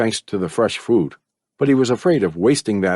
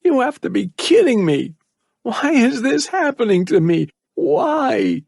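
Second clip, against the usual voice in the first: much higher, pleading despair; trapped boy crying out at his fate, higher. A narrator's reading. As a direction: cry out at the unfairness. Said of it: "He said".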